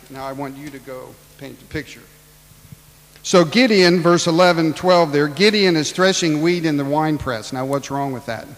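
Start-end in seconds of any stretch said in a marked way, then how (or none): 3.2-7.5 loud, high preaching to the congregation; full-force preaching. Real, not acted. Preacher opening the Gideon story, loud preaching.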